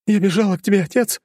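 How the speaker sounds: high daughter, winded from running; breathless daughter voice. A narrator's reading. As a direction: daughter gasping out an explanation. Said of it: "объяснила она своё поведение".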